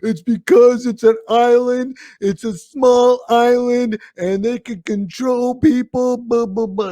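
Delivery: high, loud sing-song mockery; mock naysayer. Host mimicking critics of New Zealand, mocking. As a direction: parrot the excuse up high, dismissively. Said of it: "I know what people are gonna say".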